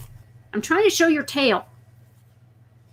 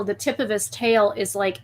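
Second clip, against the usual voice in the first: ordinary talk, nothing marked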